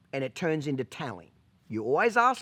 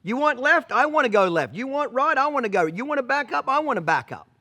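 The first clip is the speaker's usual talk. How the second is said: eager horse voice; 7 semitones higher, 4 dB louder than usual. Pretend. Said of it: "she's Johnny on the spot".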